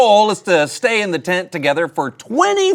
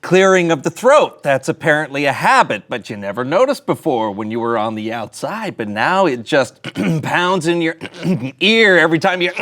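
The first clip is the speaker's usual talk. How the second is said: usual speaking voice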